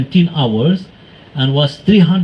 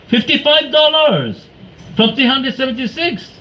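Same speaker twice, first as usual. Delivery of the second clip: amazed; 9 semitones higher than usual. Acted. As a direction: savour the bargain in wonder. Marvelling at the cheap fare.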